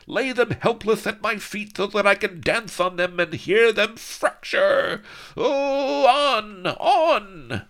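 very high, loud manic cackling orders; crazed villain shriek. Acted. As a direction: scream with mad glee. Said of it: "screamed with crazy laughter".

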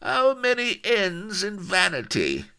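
high, loud, strange voice; high loud eccentric sailor; odd painter's voice. Acted. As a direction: pop in with a silly question. Bearded painter's face asks.

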